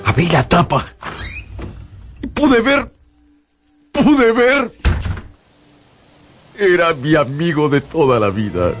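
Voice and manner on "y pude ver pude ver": high-pitched shock at a dreadful sight; stricken, raised voice; horrified. Acted. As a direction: relive the horror as you say it. Horrified at the corpse.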